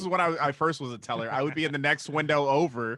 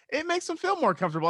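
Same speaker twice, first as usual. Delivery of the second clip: much higher, sing-song mock girl voice; mock coworker; faster than usual. Coworker defending her accent, mocked in a high voice. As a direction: parody her naive excuse. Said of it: "she was like".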